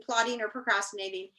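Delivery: plain reading voice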